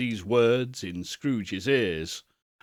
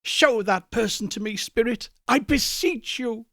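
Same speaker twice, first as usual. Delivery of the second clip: much higher, desperate beseeching; agonized; 10 semitones higher than usual. Acted. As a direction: plead in anguish. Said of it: "quite agonized".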